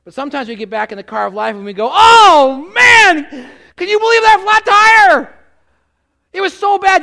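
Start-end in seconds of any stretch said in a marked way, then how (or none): 1.9-7.0 high, very loud hammed-up griping; mock complaining shout. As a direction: moan loudly about your bad luck. Retelling the flat to everyone.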